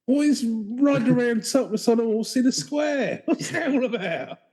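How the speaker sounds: high, slow baffled soap gossip; mock EastEnders local. Local puzzles over the Russian's hobbies, EastEnders caricature. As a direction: squawk like a puzzled soap character.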